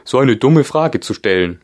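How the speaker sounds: high, loud scolding creature; scolding gryphon. A narrator's reading. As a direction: chide her sharply. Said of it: "fügte der Löwengreif hinzu". (scheltender Greif, hoch und laut)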